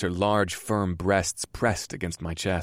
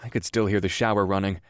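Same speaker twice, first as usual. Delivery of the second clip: ordinary delivery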